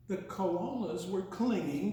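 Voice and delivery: usual speaking voice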